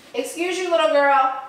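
high teasing scold to puppy; mock scolding a dog. Real, not acted. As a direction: playfully tell off the puppy.

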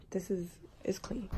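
unvoiced whispered aside; soft whispered aside to the camera. Real, not acted. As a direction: breathe it under your breath. Whispered aside about room.

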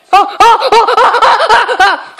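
high laughing oh oh oh; panicked laughing. Real, not acted.

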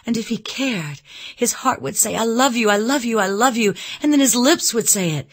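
high, loud, impassioned outpouring; all aglow. A narrator's reading. Elizabeth imagining his declaration of love, all aglow. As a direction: gush the declarations in one ardent rush. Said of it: "She was all aglow".

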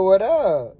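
normal talking voice

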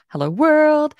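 high, loud, bright hello world; excited greeting. Pretend. Teacher trying out the test phrase, loud excited greeting. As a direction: announce it to the world.

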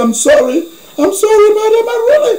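pleading apology. Retold or imitated. Man apologising to the woman.

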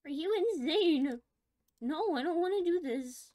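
high, loud panicked protest; frantic yelling, scared of what comes next. Real, not acted. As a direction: shout it in real panic at the game. Panicking at the game.

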